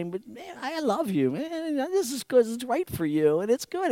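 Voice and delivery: high-pitched, earnest parental pleading. Acted. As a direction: reason lovingly with an upset child. Voices parent soothing angry child.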